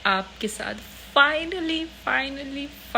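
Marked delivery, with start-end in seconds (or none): 1.2-1.8 finally thrown up high and loud; excited finally. Real, not acted. Announcing the long-awaited home tour to viewers, excited. 1.8-2.9 finally stretched very slow, then softer; drawn-out finally. Real, not acted. Teasing viewers who waited for the home tour, slow drawl.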